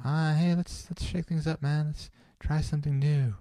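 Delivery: high-pitched, easygoing shake-it-up type; wacky free spirit. Acted. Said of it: "that's the chaotic, wacky stuff".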